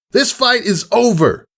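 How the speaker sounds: high, ringing order to stop; commanding mare's shout. Acted. Sky blue mare halting the colt's fight, commanding shout. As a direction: break up the fight with authority.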